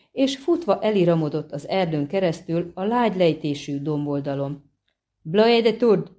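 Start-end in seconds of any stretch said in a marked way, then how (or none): 5.2-6.1 raised, higher dryad's cry; exclaimed foreign battle command. A narrator's reading. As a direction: call it out sharply, louder and higher. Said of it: "kiáltott fel a driád".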